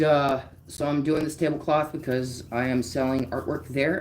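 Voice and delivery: ordinary delivery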